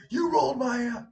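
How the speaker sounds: high-pitched accusing squawk; mock angry student. One girl to another in a fight, high mock accusation. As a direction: imitate a girl picking a fight.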